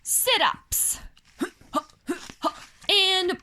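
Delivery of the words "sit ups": usual speaking voice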